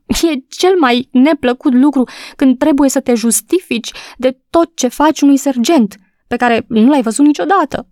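a crying woman pleading to be believed; sobbing. A narrator's reading. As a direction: voice lifted and wet with tears. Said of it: "urmă ea suspinând".

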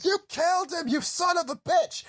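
high mock shouted accusation; retold shout. Jesse shouting at the mirror, imitated shout. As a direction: yell it at your own reflection. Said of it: "shouting at the mirror, saying".

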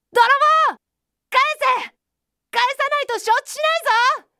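high, loud, angry yell; loud shout. A narrator's reading. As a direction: yell threats at a thief across the river. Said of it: "ウサギは大声で言いました". (高く張り上げた怒りの大声)

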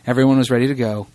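ordinary delivery

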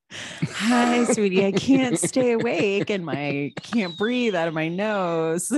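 whiny, high, slow sick whimper; snotty crying. Pretend. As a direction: whimper it with a stuffy nose. Sick voice, can't breathe through nose.

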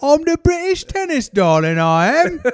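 high, hammed-up snobby British voice; mock British accent, high and pleased with himself. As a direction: play a smug British tennis star. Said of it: "Speaking of snobby British people".

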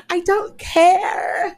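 high, drawn-out mock wail; exasperated inner outburst. Thinking it at the girl she avoids, exasperated. Inner scream after fake greeting.

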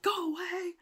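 high, soft mock wail; acted upset, thin and high; tearful dismissal. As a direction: whine it from behind a closed door. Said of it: "like, crying".

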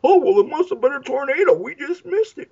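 high mock airhead girl; clueless daughter. Daughter blaming a tornado, high mock-clueless voice. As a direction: chirp it, totally oblivious. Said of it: "and then she comes out and goes".

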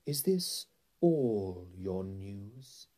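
very slow, drawn-out question; menacing queen. A narrator's reading. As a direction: stretch it out with cold royal menace. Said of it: "she asked".

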